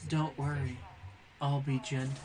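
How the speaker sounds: quiet, low mock-sultry line; soft seductive quote. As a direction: breathe it gently like a come-on. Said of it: "and says".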